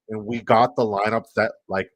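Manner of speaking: usual speaking voice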